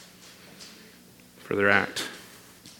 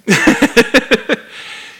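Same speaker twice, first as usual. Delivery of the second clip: high laugh; 10 semitones higher, 6 dB louder than usual. Real, not acted. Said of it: "That was a rhetorical question".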